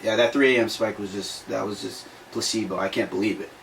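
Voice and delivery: imitating a guy admitting his burst of motivation was placebo; mock deflated mumble. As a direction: let the air out like the hype just died. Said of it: "they go back to that victim mentality".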